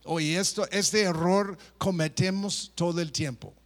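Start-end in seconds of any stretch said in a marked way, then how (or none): none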